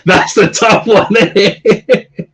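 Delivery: high, drawn-out words through real laughter; slow, helpless high-pitched laughing delivery. Real, not acted. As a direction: crack up while speaking. Cracks up at the dilemma.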